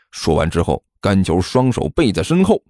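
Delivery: ordinary delivery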